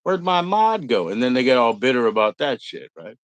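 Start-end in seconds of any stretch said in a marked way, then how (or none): 0.0-1.1 slow, high, whiny and put-upon; mock whiny question. Mocking a returning user who lost his mod status, slow and whiny. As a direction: drag out a sulky complaint. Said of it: "from him".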